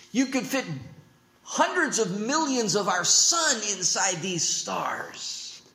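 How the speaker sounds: ordinary delivery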